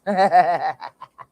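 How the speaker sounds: loud cackle at the clip; loud laugh. Real, not acted. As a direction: laugh out at the scene.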